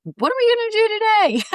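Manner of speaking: very high, giddy question; eager excitement. Retold or imitated. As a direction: squeal it like an excited kid. Voices her eager morning self.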